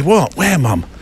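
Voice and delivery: lightly voiced kid calling to mum; puzzled child. Kid asks mum where to look, puzzled.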